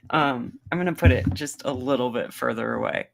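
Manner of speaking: ordinary talk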